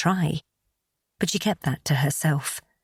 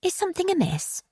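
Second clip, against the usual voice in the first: worried sister-in-law. A narrator's reading. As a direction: ask anxiously in a higher voice.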